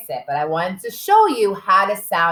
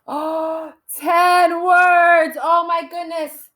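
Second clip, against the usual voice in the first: high, loud mock astonishment; big playful shock, high-pitched; amazed gasp; 8 semitones higher, 4 dB louder, much slower than usual. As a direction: gasp and marvel for the children. Mock shock at long sentence.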